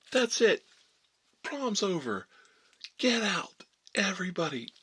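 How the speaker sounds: high, loud imitation of an outraged teacher; acted furious shouting, each order punched and falling; bombastic bellow. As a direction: throw everyone out with big lungs. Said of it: "he was just a bombastic, big lungs, big guy".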